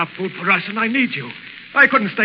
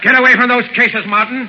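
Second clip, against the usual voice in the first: angry order; 5 semitones higher, 4 dB louder than usual. Acted. Burkett orders Martin from food.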